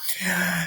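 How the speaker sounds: sharp frightened gasp. Acted. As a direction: suck in a startled breath. Ron swipes at Peeves.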